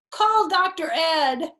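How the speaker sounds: high, loud, slowed urgent demand; dramatic plea. Acted. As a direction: overact the emergency. Fox demanding the doctor dramatically.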